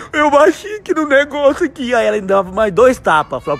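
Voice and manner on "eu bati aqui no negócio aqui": put-on crying child. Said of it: "Aí tava em casa ainda chorando".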